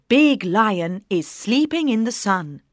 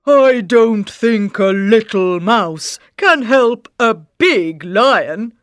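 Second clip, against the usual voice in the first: doubting lion; 6 dB louder than usual. Acted. Lion laughs off the mouse's offer, loud. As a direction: scoff like a big lion. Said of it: "says the lion".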